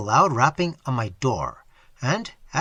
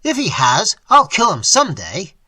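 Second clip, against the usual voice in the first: loud, higher vow of revenge; furious threat; 5 semitones higher, 7 dB louder than usual. A narrator's reading. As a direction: threaten him in a rage. Furious threat against rival.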